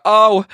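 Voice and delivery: high, loud mock wail; imitated bawling howl. Grandma bawling after learning of the joke, imitated howl. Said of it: "crying, like a howl".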